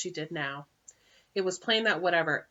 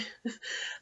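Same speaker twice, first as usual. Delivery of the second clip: whispered laugh; 7 dB quieter than usual. Real, not acted. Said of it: "I'm sorry".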